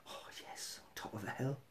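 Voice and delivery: breathy hushed whisper, almost no voice. Real, not acted. Whispering to viewers about camping on a hill. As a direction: whisper it like a secret delight.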